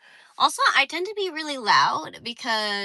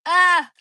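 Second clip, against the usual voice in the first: sharp loud cry; 7 semitones higher, 8 dB louder than usual. Real, not acted. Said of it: "loud exclamation".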